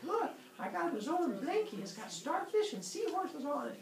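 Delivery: high-pitched mock-feminine cooing; put-on high mommy voice; doting mother. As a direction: show off the baby in a high voice. Voices doting mother showing baby.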